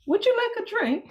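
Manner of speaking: high, bright polite host offer. Retold or imitated. Caribbean guest mimicking an English host's drink offer.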